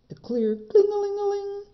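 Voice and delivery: high sing-song imitation of a little bell; bell tinkle. Narrator imitating the distant goat bell, high and sing-song. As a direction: ring it out like a tiny bell.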